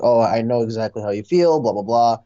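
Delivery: usual speaking voice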